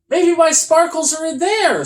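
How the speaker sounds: high, loud and eager; excited. Acted. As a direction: burst out with hope. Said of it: "said Toby".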